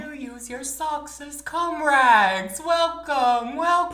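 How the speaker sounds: high, loud, slow eerie voice; mock witch greeting. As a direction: ham up the ghost's welcome.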